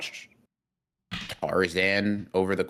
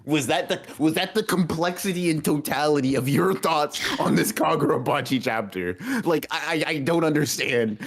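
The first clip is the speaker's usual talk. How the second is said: mocking exasperation; 8 semitones higher than usual. Real, not acted. Mocking rant at friend.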